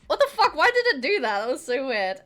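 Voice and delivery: loud, high disbelief; shocked outburst. Real, not acted. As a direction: blurt it out in shock. Reacts to glitchy alert.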